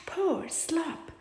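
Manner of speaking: ordinary delivery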